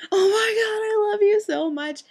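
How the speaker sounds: high, loud, drawn-out gushing cry; overjoyed squeal. Retold or imitated. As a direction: shriek it out slowly in grateful ecstasy. Reenacts the overjoyed bear hug.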